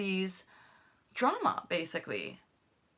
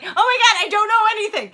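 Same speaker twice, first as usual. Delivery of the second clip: high, loud, panicky wail; panicked; 12 semitones higher, 12 dB louder, faster than usual. Retold or imitated. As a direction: freak out in sudden self-doubt. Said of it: "and I'm like".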